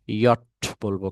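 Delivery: ইয়ট said as German jot, slowly; German letter J. Shown as a demonstration. Teacher naming the German letter J, slow and careful. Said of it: "এটাকে আমরা ইয়ট".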